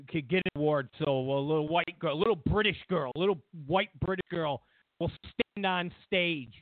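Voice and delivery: ordinary talk, nothing marked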